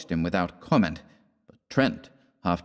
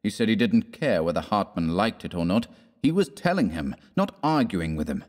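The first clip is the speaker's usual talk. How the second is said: ordinary delivery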